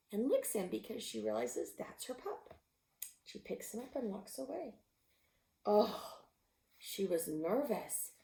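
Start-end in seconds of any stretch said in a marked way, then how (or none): none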